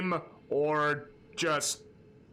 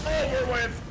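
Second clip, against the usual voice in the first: shouting; 11 semitones higher, 5 dB louder than usual. Real, not acted. Boss fight hype.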